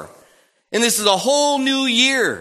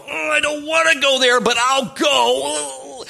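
higher, dragging reluctant whine; reluctant follower; 7 semitones higher than usual. Retold or imitated. Believer reluctantly agreeing to follow, higher and slow. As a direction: drag out the reluctant yes. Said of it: "you go".

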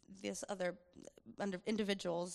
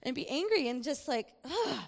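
louder, higher whiny refusal; sulky inner voice; 7 semitones higher, 4 dB louder than usual. Retold or imitated. Her sulking inner voice refuses to repent, whiny. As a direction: pout like a bitter child who won't budge. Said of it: "the other part of me was just".

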